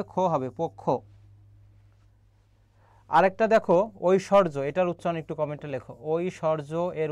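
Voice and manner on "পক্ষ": "পক্ষ" as pok-kho, with a doubled k; joined pronunciation. Shown as a demonstration. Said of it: "তাহলে পোক আর কি হবে".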